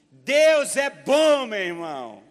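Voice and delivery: booming, slow proclamation; preaching at full force. Real, not acted. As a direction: proclaim it to the church, loud and slow. (pregação em voz forte e lenta)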